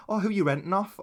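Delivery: mock posh renter. Tenant asking about landlord, chirpy mock voice. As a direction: ask it brightly. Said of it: "Three hundred grand for a flat".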